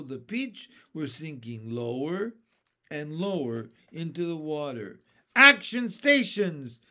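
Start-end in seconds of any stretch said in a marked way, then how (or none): 5.3-6.8 loud, slow captain's shout; shouted command. Acted. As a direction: bellow the order to the crew. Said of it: "James shouted".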